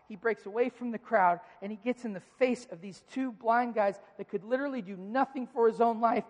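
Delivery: ordinary talk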